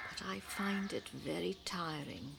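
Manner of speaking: tired, breathy whisper; weary whisper. Acted. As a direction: sigh it out, worn down. Tired aunt.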